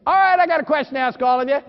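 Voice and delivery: ordinary talk, nothing marked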